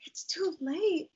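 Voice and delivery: high slow whisper; whispered thought. Retold or imitated. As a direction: whisper it to yourself in disbelief. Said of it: "I remember thinking".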